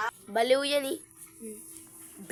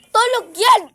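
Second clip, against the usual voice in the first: calling out; 8 semitones higher, 10 dB louder than usual. Real, not acted. Teasing call at child.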